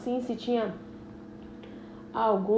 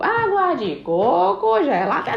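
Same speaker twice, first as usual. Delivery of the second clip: vendor call; 6 semitones higher, 5 dB louder than usual. Acted. Coconut water vendor calling out.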